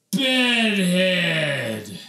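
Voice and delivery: dramatic shout. A narrator's reading. Dramatic reveal in story.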